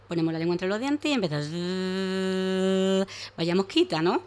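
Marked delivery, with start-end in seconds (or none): 1.5-3.1 housefly buzz. Shown as a demonstration. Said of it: "podemos imitar una mosca".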